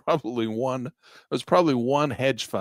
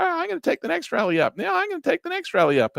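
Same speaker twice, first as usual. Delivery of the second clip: repeated higher still, a hammed-up chant of hope; mock insistent trader. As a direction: mimic a trader talking himself into it, very high. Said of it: "And then you tell".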